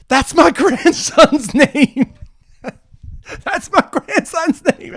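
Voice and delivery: high, slow, breaking into laughter; laughing in disbelief. Retold or imitated. Woman telling the comedian the name, laughing. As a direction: laugh through the words at the coincidence.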